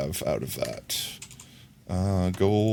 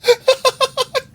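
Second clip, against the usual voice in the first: tiny chipmunk-pitched giggle; high squeaky laugh as a tiny figure; 8 dB louder than usual. Pretend. Squeaky laugh as mini doll.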